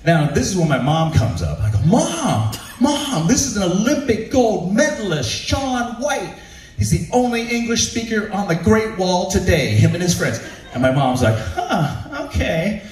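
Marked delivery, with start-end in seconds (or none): 1.8-10.4 excited introduction. Retold or imitated. Excited son introducing celebrity. 11.4-12.8 mom unimpressed. Retold or imitated.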